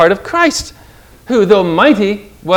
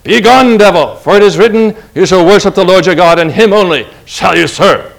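louder, forceful command to the devil; roaring rebuke; 5 dB louder than usual. Retold or imitated. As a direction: roar it back at the tempter. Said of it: "Jesus roared back at him".

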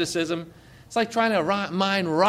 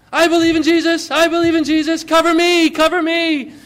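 put-on high, loud eager child's plea; cover me shout; 11 semitones higher, 5 dB louder than usual. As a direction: kids calling out excitedly. Children eagerly responding to gospel.

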